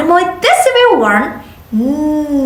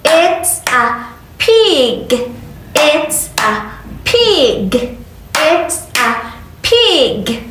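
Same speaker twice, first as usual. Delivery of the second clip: slow modeling; 2 semitones higher, slower than usual. Shown as a demonstration. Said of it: "teacher models slow for repeating".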